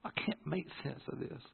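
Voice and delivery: very soft, slightly high plea; quiet prayer. Retold or imitated. Son talking to God beside sleeping dad, hushed plea. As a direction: confide it to God in a hush. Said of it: "I'm sitting here talking to God".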